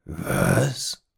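put-on a short gruff growl from an old man. A narrator's reading. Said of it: "knurrte der alte Mann". (kurz knurrend)

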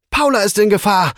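high-pitched alarm call; urgent call. A narrator's reading. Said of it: "rief er seinem Drachen zu".

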